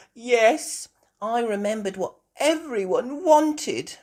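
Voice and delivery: put-on high, loud child voice after a yawn. Peppa home in the evening, yawning. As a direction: yawn in, then speak up high. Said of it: "Peppa yawned".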